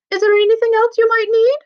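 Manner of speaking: high, loud sing-song Irish brogue; Irish steward peeping in at bedtime; jolly Irish accent. Acted. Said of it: "Irish accent ... like a jolly song".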